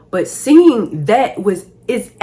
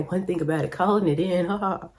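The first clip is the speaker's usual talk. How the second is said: usual speaking voice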